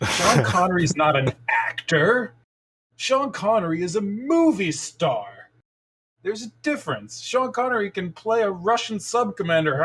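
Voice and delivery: lightly voiced comedian ranting about Sean Connery; Craig Ferguson bit. Comedian mocking Connery's acting, in a high lightly done voice. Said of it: "He's like".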